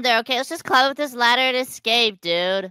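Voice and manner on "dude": ordinary delivery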